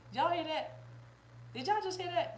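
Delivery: startled, laughing at yourself; surprised. Real, not acted. Reader asking the live chat about her own slip, surprised.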